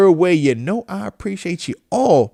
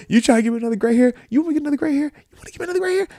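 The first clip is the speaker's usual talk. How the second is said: climbing higher and softer, a put-upon whine; exasperated mock complaint; 11 semitones higher, 3 dB quieter, much faster than usual. Scolding a troublesome friend in a rising exasperated whine. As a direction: whine it like your friend keeps stressing you out. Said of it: "You go".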